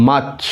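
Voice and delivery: মার্চ as "maach", r dropped; silent-r March. Shown as a demonstration. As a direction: say মার্চ with a long "aa" and no r. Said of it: "র এর উচ্চারণটা হবে না".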